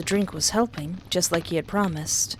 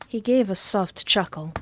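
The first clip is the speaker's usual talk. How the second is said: ordinary delivery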